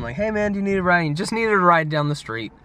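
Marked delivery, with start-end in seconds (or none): 0.2-1.0 mild retold yell, raised pitch; calling out. Driver offering a stranger a ride, calling out. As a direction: call lightly over to someone, higher voice. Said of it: "I yelled at him".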